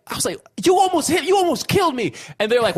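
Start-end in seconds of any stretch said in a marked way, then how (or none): 0.6-2.1 high-pitched outraged reenactment; high indignant mock shout; retold screaming. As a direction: act out yelling at a careless driver, very high. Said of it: "so many screaming".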